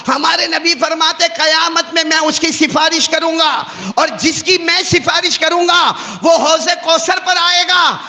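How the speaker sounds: high, loud sermon cry; fervent preaching. Acted. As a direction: lift the hadith up and out to the hall.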